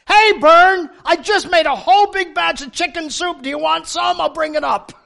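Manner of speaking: bright, loud neighborly call over the phone; neighbor's eager offer, voiced up high and loud; cheery neighbor. Neighbor calls offering chicken soup, cheerful and loud.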